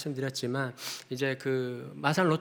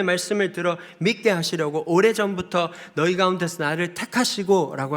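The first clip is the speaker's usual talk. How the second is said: loud, raised, declamatory sermon voice; emphatic preaching; 5 semitones higher, 7 dB louder than usual. Real, not acted. Pastor expounding the gospel's essence to the congregation, emphatic preaching. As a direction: preach it out forcefully, pitched high.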